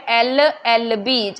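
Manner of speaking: very slow, letter by letter; careful teacher spelling; slow letter demo. Shown as a demonstration. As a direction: sound out each letter slowly. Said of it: "ए की sound आ रही है".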